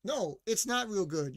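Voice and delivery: usual speaking voice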